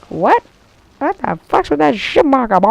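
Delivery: high, loud, swooping exclamation; mock outrage. Gamer reacting to a hit marker, mock outrage. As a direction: ham up indignant disbelief.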